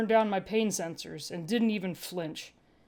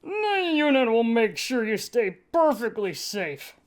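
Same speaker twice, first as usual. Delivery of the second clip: a lightly voiced smug officer; lightly put-on Cad, falsely reassuring; Cad's voice; 3 semitones higher than usual. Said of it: "cad said".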